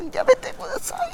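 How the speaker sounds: very high, breathless squeal; anime moan. Shown as a demonstration. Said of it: "pues allí es". (gemido agudo y sin voz de anime)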